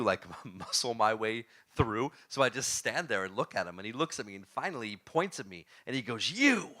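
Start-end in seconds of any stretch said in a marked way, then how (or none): 6.3-6.7 high, loud drawn-out "you"; single pointed cry, pitched way up; accusing call-out. Retold or imitated. Attorney singling out the interviewee outside the bathroom, accusing call-out.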